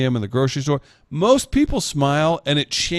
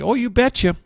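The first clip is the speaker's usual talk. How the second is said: higher, sing-song Minnesota "Oh, you betcha."; mock Minnesota accent; 10 semitones higher than usual. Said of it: "that's a Minnesota thing".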